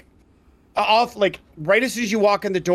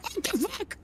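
startled squeak; 11 semitones higher, 13 dB quieter than usual. Acted. Alchemist startled by intruder.

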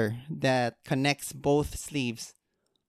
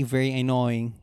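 ordinary talk

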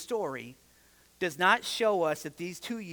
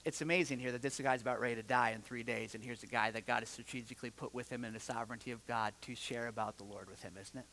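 much softer, lower and fast; hushed aside; 9 semitones lower, 11 dB quieter, faster than usual. Real, not acted. Preacher confiding to the congregation, hushed and fast. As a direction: drop it to a quick low murmur.

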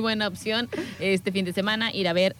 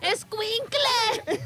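exasperated cry; 11 semitones higher, much slower than usual. Acted.

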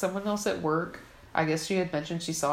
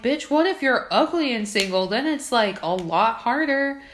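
indignant retort; 6 semitones higher, 5 dB louder than usual. Retold or imitated.

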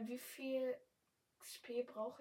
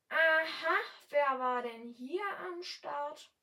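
clumsy attempt at a regional German dialect, slow and louder; fake dialect; 5 semitones higher, 10 dB louder, slower than usual. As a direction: put on a regional German dialect you can't really do, dragged out. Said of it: "Versucht im Dialekt zu reden". (schlecht imitierter deutscher Dialekt, langsam)

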